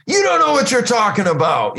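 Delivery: mock indignant outburst, pitched high; high-pitched, put-on protest; upset retort. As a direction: imitate an upset man snapping back in a high voice. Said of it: "he's getting upset".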